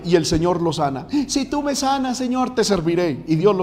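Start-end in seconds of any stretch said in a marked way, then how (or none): 1.3-3.2 high, imitated bargaining plea; promesa suplicante imitada. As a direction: mimic someone pleading a deal with God. Mimics someone bargaining with God. (ruego agudo con promesa, imitado)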